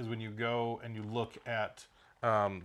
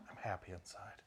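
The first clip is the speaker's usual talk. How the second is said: unvoiced ASMR whisper; tingly asmr mouth sounds; 3 semitones lower, 12 dB quieter than usual. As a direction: breathe it like an ASMR video. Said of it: "this has become an A S M R channel".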